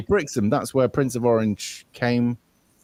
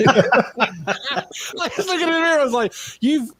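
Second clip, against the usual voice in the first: high-pitched giggling through the words; laughing; 13 semitones higher than usual. Real, not acted. Laughing at his baggy-eyed reflection in the mirror. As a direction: laugh your way through the line.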